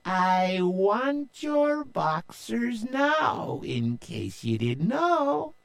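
high, slow demanding pirate; Stinky Annie. A narrator's reading. Pirate captain cornering Joe, slow and high. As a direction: menace him slowly. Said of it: "She said".

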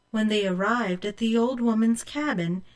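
plain reading voice

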